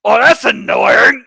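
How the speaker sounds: loud, high, drawn-out creature bellow; annoyed monster roar. Acted. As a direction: roar it as the monster behind the wall. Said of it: "And you just he-, hear".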